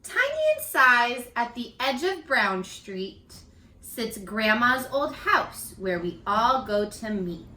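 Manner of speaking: read-aloud storytelling. Acted. Teacher reads picture book aloud to her young students. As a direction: read it to children, savoring each line.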